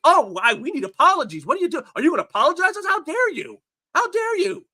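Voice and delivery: high, squeaky hammed-up indignation; mock outrage. Sneering at cancel pigs in a high indignant whine. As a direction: parody a shocked scold demanding apologies. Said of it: "when you see cancel pigs".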